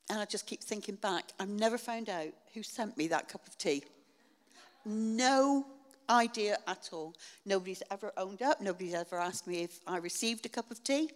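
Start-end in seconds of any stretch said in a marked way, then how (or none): 4.8-7.1 louder, higher marvel; emphatic no idea. Real, not acted. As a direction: land it with wonder. Still amazed at the gift.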